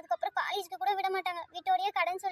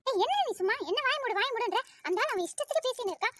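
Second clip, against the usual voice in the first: raised-pitch, huffy arguing; indignant retort; 3 semitones higher than usual. Acted. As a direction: argue back, refusing to be silenced. Girl argues back at her friend. (கோபமாக எதிர்த்துப் பேசுவது)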